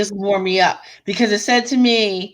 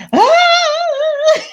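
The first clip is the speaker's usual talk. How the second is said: retold shocked scream, very high; shrieking surprise from a reading friend; 6 dB louder than usual. Beta reader at work screams at the book, high shriek. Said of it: "And she's".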